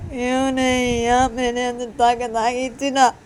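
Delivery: loud, high-pitched acted wailing; crying. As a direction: whine and sob in frustration. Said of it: "अम्मा रोते रोते".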